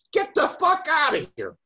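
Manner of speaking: high-pitched acted yell; retold furious shout. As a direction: imitate yelling someone out, pitch climbing. Retold furious order to leave.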